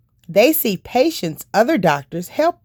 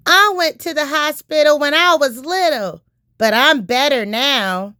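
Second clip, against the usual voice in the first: put-on pitched higher as a young boy; child's voice; 8 semitones higher than usual. J J raising his hand to tell the doctor, higher child voice. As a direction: play J J, an eager little kid.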